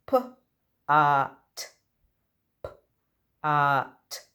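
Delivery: p-a-t, each sound stretched and separate, loud. Shown as a demonstration. Teacher sounding out a word for children to guess, loudly. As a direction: sound out every letter slowly. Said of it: "I'm going to sound out the words".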